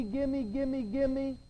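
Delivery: normal talking voice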